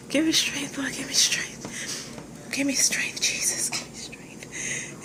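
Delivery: whispered plea, holding back laughter; praying for patience through teeth. Real, not acted. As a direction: breathe it out, begging patience.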